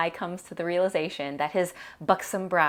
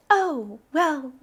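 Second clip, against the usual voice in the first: high, controlled "oh, well"; forced steadiness; 9 semitones higher, 4 dB louder than usual. A narrator's reading. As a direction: daughter holding back rage. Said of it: "I keep my voice steady".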